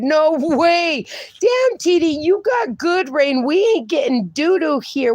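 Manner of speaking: high-pitched, excited surprise; amazed disbelief. Real, not acted. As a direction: react amazed to a friend's news. Amazed at viewer's rain news.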